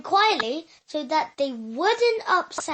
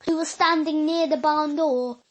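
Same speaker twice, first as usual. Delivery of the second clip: ordinary delivery, nothing marked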